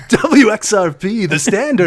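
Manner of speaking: slow, high radio station ID voice; radio announcer. Pretend. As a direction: boom it out like a jingle. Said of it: "like a radio station".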